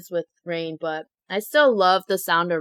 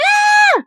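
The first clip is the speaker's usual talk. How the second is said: sudden high yelp of fright, much higher and louder; startled scream; 6 dB louder than usual. Retold or imitated. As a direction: shriek as the lightning flashes. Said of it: "And then I was like".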